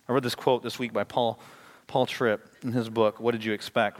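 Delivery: ordinary talk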